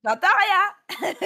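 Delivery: slow teasing sing-song. Pretend.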